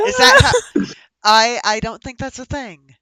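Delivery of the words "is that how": laughing hard while talking; words squeezed out through laughter. Real, not acted. Laughs at bardic inspiration joke.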